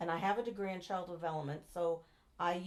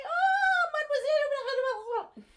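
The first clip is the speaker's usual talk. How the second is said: much higher, swooning mock-French "oh, mademoiselle"; delighted French local; 11 dB louder, much slower than usual. French local charmed by the American girl, high swooning gush.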